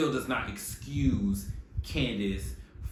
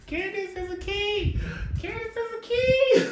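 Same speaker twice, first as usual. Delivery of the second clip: very high, piercing put-on voice; playful falsetto squeal; high-pitched mock squeal; 5 dB louder than usual. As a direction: squeal it way up high, louder on the repeat. High squealed mock chant, repeated.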